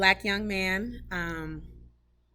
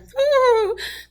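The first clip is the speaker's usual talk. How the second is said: tearful laugh; 6 dB louder than usual. Real, not acted. Breaks down while quoting Cole.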